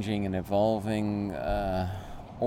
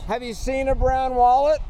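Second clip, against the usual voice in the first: high, loud call to strangers; 14 semitones higher, 7 dB louder than usual. Real, not acted. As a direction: call out across the street. Calling to strangers about wallet.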